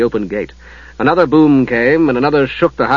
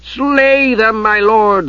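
crying out; 9 semitones higher, much slower than usual. Acted. Night Lord urging Ariakan to kill the prisoners. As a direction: cry out for their deaths. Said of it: "the Night Lord cried".

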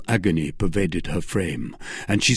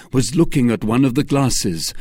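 ordinary delivery

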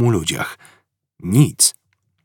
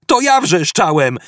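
shouting; 9 semitones higher than usual. A narrator's reading. Arthur answers Ford's question, exasperated shout. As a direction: yell back at him. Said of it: "krzyknął Artur".

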